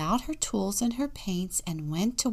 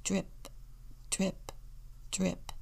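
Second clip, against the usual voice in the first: soft, short, separate drips; quiet, spaced-out drips; dripping sound effect; 9 dB quieter, slower than usual. A narrator's reading. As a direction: let each word fall like a drop of water. Narrator imitates water dripping.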